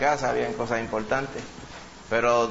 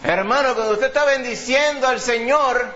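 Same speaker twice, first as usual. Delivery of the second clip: higher, louder, slower call; fervent preaching; 9 semitones higher, 4 dB louder than usual. Real, not acted. Preacher urging brothers to keep praising, fervent call. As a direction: rouse the flock with full force.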